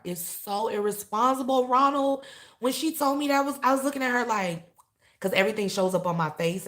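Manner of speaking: loud, high exasperation; emotional outburst. Real, not acted. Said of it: "getting emotional".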